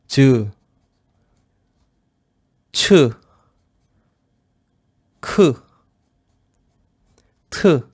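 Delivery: each e-syllable said once, clearly and loud; syllable drill. Shown as a demonstration. Teacher pronouncing e-syllables once for students. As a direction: call out each syllable distinctly. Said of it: "je prononce une fois".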